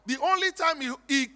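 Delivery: ordinary delivery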